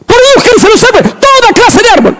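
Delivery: high, ringing divine command; voice of God commanding. Acted. Preacher voicing God's command at creation. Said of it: "todo Dios lo creó con su palabra".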